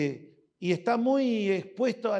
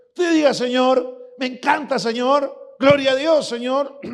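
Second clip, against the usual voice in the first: high, loud joyful praise; exuberant praise; 5 semitones higher, 8 dB louder than usual. Acted. Preacher acts a worshipper praising God, loud and high. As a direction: cry out praise to the Lord.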